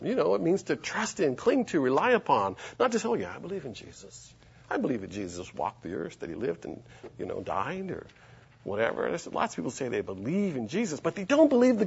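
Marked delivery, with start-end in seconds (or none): 3.1-9.1 quiet, low, dismissive throwaway; mock casual belief, dropped low and soft; offhand mimicry. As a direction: toss it off like a half-hearted believer. Mimics shallow, casual professed belief.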